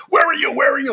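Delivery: high-pitched mock yell, accusing; imitated screaming wife. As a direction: imitate a frantic wife demanding an answer. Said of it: "she's trying to catch me".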